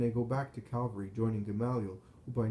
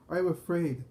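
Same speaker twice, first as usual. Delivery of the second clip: high, louder frightened cry; moaning in fear; 7 semitones higher, 5 dB louder than usual. A narrator's reading. Collapsed Pharisee moaning on the ground in fear. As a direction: wail it out, pitch well raised.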